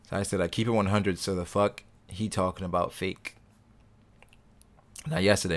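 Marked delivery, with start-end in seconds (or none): none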